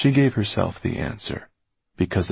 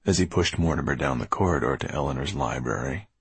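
ordinary delivery

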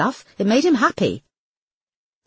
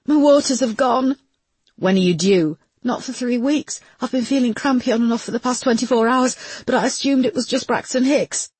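higher, strained pregnant woman; anxious pregnant woman; 8 semitones higher than usual. Acted. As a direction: voice her tense and worried. Woman in labour voiced.

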